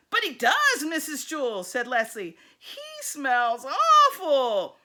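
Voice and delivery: whiny protest. Acted. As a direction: plead it like the teacher is being unfair. Leslie protests to the teacher.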